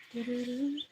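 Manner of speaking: ordinary talk, nothing marked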